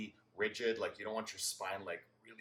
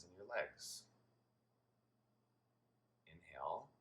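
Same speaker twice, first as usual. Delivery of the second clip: guided meditation; 3 semitones lower, 8 dB quieter than usual. Real, not acted. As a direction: guide them gently through each breath, hushed and unhurried. Leads a guided breathing meditation.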